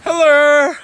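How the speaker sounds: loud, high, long-held hello; shouted greeting. Real, not acted. Vlogger greeting the camera to open the vlog, shouted greeting.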